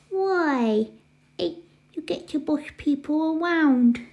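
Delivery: put-on babyish questioning little brother; cutesy baby talk. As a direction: argue like a toddler. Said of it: "stop talking in that stupid baby voice".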